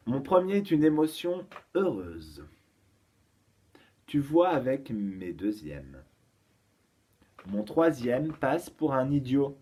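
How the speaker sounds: put-on deliberate, spaced-out charade for children; careful teacher's dictation of a riddle; riddle reading. Shown as a demonstration. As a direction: present each clue distinctly, with pauses between. Reads out the charade slowly.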